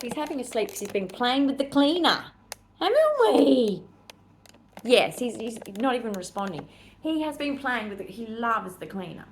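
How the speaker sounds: lightly sing-song talk to Max; pet talk. Real, not acted. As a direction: coo at the dog. Talking about and to her dog Max.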